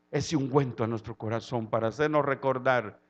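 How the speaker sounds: usual speaking voice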